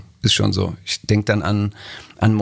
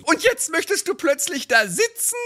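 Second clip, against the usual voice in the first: hohe, drängende Ehefrauenstimme; nörgelnde Ehefrau; 14 semitones higher than usual. Acted. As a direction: den Mann antreiben. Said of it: "so ein Lieblingstext". (Ehefrau drängt ihren Mann, hohe Stimme)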